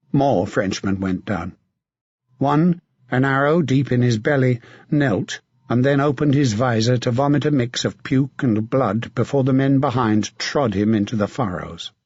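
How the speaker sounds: plain reading voice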